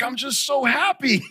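imitated tearful child. Dad quoting his seven-year-old after prayer, imitated happy crying. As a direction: imitate a boy tearing up from happiness. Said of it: "he starts crying".

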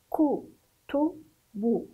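"كو، تو، بو" as separate syllables with short u. Shown as a demonstration. Teacher shows pupils how to pronounce, syllable by syllable "كو، تو، بو". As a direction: sound out "كو، تو، بو" one beat at a time. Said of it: "انظروا كيف أنطق".

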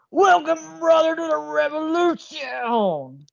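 high, slow gravelly wrestler promo voice; over-the-top wrestling hype voice; Macho Man impression. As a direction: ham it up like Macho Man Randy Savage. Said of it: "gonna do a bad Macho Man impression".

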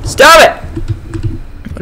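high shriek, then a breathy whispered stop it; scared yelp. Real, not acted. Gamer attacked by Lord Craven, high scared yelp. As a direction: panic then gasp.

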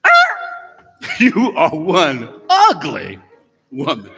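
very slow, loud squawk, laughing through it; squawking parrot. Acted. Parrot insults the lady a second time, squawking slowly. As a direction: drag out the insult like a cheeky bird. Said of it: "Parrot looks at her".

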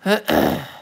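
ordinary talk

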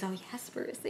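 very soft, imitated coaxing; mock seductive tempter. Viewer voicing the tempter luring Percy, hushed mock seduction. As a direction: murmur it as a pretend temptress.

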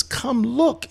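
imitating his tearful little daughter; little girl. Daughter pulling dad to her drawing, high childlike plea. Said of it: "And she says".

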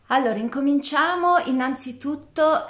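rally everyone to begin; energetic start. Real, not acted. Launching the session.

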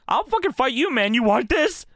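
high-pitched mock fight talk; retold screaming challenge. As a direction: imitate a wrestler picking a fight. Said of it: "He's just screaming at them".

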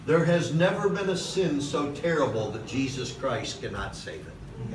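usual speaking voice

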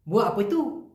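"Buat apa tu" said clipped, with "apa" shortened to "pe" and a rising "tu"; short Malaysian speech. Shown as a demonstration. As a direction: run "Buat apa tu" together fast, like a local, ending on a rise. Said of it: "ঠিক এভাবেই short করে বলে". ("Buat apa tu" ছোট করে, শেষে "tu" উঠিয়ে)

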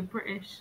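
soft, quiet echo of "British" as the learner says it; imitated pronunciation. Teasing the learner's way of saying it, imitated pronunciation. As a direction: imitate the other speaker's "British", softly. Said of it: "You go".

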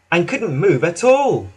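ordinary delivery, nothing marked

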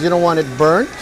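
ordinary talk

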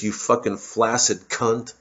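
ordinary talk, nothing marked

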